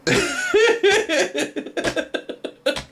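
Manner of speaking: long high giggling laugh. Real, not acted. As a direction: let the laugh run on high. Laughing at the game.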